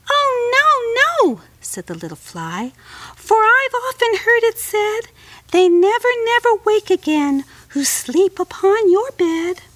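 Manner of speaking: very high, frightened little fly; high tiny fly voice. Acted. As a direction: squeak it like a scared tiny insect. Fly refuses again.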